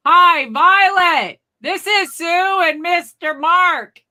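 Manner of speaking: very high, loud, drawn-out shout; mock yelling. Visitors greeting granny at her door, mock yelling. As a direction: holler it slowly as if she were hard of hearing. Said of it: "Why are you guys yelling?".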